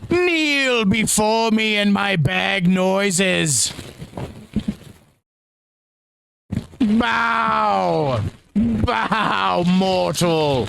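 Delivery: high, slow, commanding demon voice; ancient rasping undead king. Pretend. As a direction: order mortals to kneel like a demon lord. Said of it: "Satan and all".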